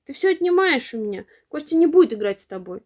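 little brother shouting at his teasing sister; hurt shouting. A narrator's reading. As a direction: throw it at her in tears. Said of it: "кричит ей Костя".

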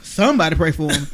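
ordinary talk

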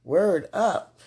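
loud playful throwback catchphrase; loud nineties hype; nineties slang. Pretend. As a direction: belt out the nineties slang for fun. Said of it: "I had to hit that nineteen nineties".

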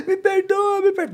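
high, gasping mock cry; imitated tearful begging. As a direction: burst into a whiny imitation of pleading. Reenacts his sudden tearful apology. (imitando súplica chorosa, bem aguda)

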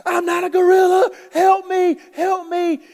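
high, loud, desperate scream for help; terrified full-voiced shriek; panicked screaming. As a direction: yell it at the top of your voice, pleading for rescue. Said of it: "he starts to yell and scream".

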